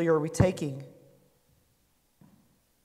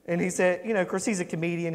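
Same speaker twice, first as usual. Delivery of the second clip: normal talking voice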